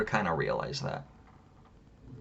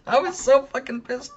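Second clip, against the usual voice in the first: laughing; 3 dB louder than usual. Real, not acted. Laughing after retold yell.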